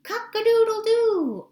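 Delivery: rooster crow. Acted. Teacher doing the rooster's crow for kindergarten, loud and high. Rooster crows in read-aloud.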